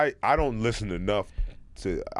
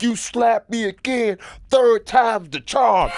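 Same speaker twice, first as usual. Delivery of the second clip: high, loud and slow, acting big; retold yell, drawn out and pitched up; mock tough yell; 12 semitones higher, 8 dB louder, slower than usual. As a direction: threaten her like a movie tough guy. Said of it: "So I remember yelling".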